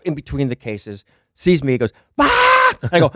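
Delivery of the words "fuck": very high, loud startled shriek; startled scream. Retold or imitated. Girl popping out between cases sees a stranger, startled scream. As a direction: scream the swear in sudden shock.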